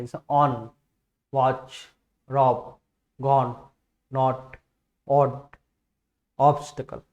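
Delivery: on watch rob said with a short clipped o; short o demo. Shown as a demonstration. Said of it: "बहुत छोटा ओ".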